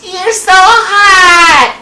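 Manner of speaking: put-on high, drawn-out loud Valley girl whine. Imitating the daughter's Valley girl voice, high and drawn out. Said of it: "Why do you sound like a Valley girl?".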